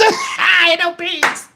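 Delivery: imitating a little brother's slow, drawn-out protest; mock kid yell. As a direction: yell it out like a kid who refuses. Brother yelling refusal in car.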